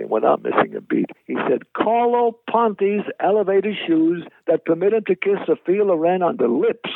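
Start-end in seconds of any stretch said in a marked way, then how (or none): 1.7-6.9 high-pitched declaiming comic voice; grandly proclaimed high quip; imitated comedian's punchline. Acts Mel Brooks's instant punchline.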